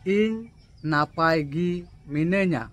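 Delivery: ইন নাপায় গি মিনাñা said slowly and carefully; slow repeat. Shown as a demonstration. Teacher repeating the Santali answer slowly for learners. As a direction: say it again slowly so they can copy. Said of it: "আবার বলছি".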